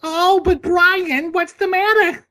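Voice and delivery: very high, loud, slow put-on voice; cartoon voice. Quoting a Family Guy character to Brian, high put-on voice.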